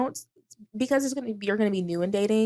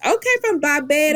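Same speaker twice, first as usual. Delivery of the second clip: high-pitched attempt at a Bajan accent; bad Barbados accent; 7 semitones higher than usual. Host greeting a listener from Barbados, in a bad Bajan accent. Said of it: "That was a bad accent".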